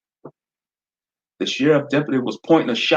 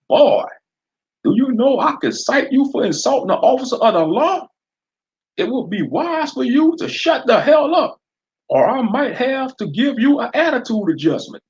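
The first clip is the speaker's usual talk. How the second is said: much higher, loud southern sheriff taunting; southern sheriff daring Fred to use his eye blaster; southern accent; 14 semitones higher, 4 dB louder than usual. Acted. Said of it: "he replied".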